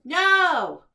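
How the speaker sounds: big booming shouted no; loud no. A narrator's reading. Storyteller booming the monsters' favorite word, loud. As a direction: belt out the final no.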